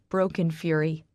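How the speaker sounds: usual speaking voice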